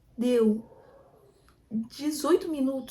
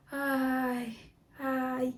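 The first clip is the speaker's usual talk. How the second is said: relaxed moan. Shown as a demonstration. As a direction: demo the releasing groan. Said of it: "quando você faz uma respiração e geme".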